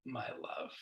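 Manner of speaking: whispered tender endearment; gentle whisper. Retold or imitated. Speaker voices Pascal soothing himself after a mistake, whispered tenderly. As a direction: let the words fall soft and kind. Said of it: "he has this very gentle voice".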